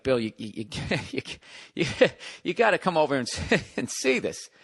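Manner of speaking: stammering through laughter; retold laughing call, voice rising; laughing contractor. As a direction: giggle as you stammer the words out. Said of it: "he's actually laughing".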